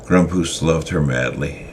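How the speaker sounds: ordinary delivery, nothing marked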